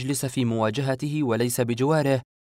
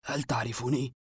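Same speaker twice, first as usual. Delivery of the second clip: hushed, wondering question; astonished; 6 dB quieter than usual. A narrator's reading. Unmasked man clutches at a last hope, quietly astonished. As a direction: ask softly, in quiet amazement. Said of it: "تأملني بدهشة وهو يقول".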